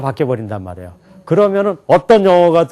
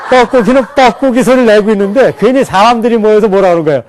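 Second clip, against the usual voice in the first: higher and louder, laughing through it; excited, laughing; 6 semitones higher, 6 dB louder than usual. Real, not acted. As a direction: tell it with a laugh in the voice. Teacher jokes about cuckoo. (웃으며 신나게 높고 크게)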